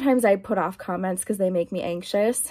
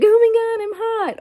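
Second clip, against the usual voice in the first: much higher and louder mock whine; mock complaint; 13 semitones higher, 6 dB louder, much faster than usual. Vlogger parodying her own constant heat complaints, high and loud. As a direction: whine it like a comic self-parody. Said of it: "I'm like".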